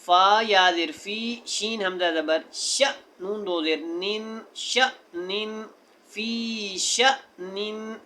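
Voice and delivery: teacher sounds out फ़ी श निन letter by letter; spelling out syllables. Shown as a demonstration. Teacher spells the word aloud syllable by syllable for students. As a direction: break the word into its letters and vowels. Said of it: "आइए इसको सीखते हैं".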